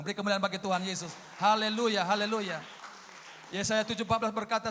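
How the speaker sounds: loud, high declamatory sermon; forceful preaching. Real, not acted. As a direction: proclaim scripture to rouse the congregation.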